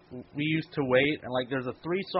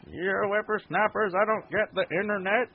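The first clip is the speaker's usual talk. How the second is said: put-on grumpy old man; 7 semitones higher, 3 dB louder than usual. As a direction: play a cranky old man lecturing. Mocking old man complaining.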